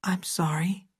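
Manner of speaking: weak, sad whisper; Kathy whispers her apology to the doctor, weak and sad. Acted. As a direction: breathe the apology out in sorrow. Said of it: "a weak sadness took its place".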